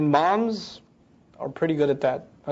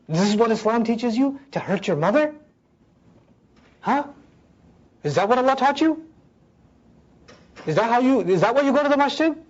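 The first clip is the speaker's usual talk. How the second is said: higher-pitched, indignant rhetorical questioning; hurt parent's accusation; 4 semitones higher than usual. Acted. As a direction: challenge the child, pitch lifting on each question. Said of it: "and they're hurt".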